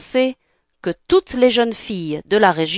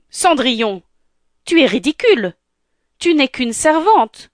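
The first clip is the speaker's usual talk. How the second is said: raised, high-pitched disdain; scornful stepsister; 6 semitones higher, 4 dB louder than usual. Acted. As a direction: put the servant girl down, rising in pitch. Stepfamily mocks Cinderella. (mépris, voix de plus en plus aiguë)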